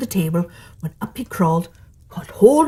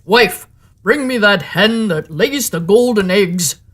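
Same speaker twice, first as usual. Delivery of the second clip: slow, heavy giant command; the ogre demanding his golden hen, slow and heavy; ogre's order; 3 dB louder than usual. A narrator's reading. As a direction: order her about like a lazy giant.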